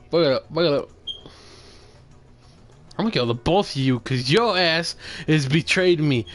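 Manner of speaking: normal talking voice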